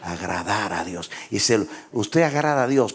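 usual speaking voice

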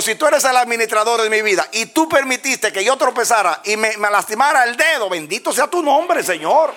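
high, impassioned acted-out prayer; fervent praise. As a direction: cry it up to God in defiant thanks. Alaba a Dios tras tropezar. (alabanza exaltada en voz aguda)

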